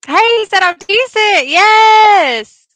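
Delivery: high, loud triumphant cheer; real delighted outburst; excited. Real, not acted. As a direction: celebrate with a long, loud yes. Delighted at praise for accent.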